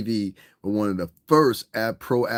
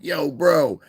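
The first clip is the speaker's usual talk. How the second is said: suburban kid impression; 6 semitones higher, 4 dB louder than usual. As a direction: toss off a hammed-up suburban greeting.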